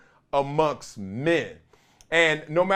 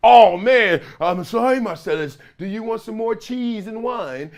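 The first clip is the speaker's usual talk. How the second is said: mock fawning teammate; 4 semitones higher, 4 dB louder than usual.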